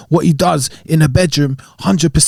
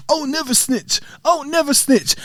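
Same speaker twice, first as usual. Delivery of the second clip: higher, softer mimicking of onlookers; glib mock advice; 8 semitones higher, 6 dB quieter than usual. Host mimicking outsiders who find it easy to say, glibly. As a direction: imitate people giving easy advice, pitched up and light. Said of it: "It's so easy to say".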